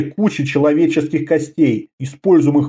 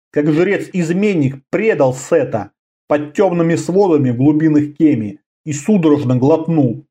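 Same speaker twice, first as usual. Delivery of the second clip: plain reading voice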